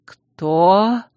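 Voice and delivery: cracking shout; shout, voice breaking. A narrator's reading. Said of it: "Голос герцога сорвался на крик".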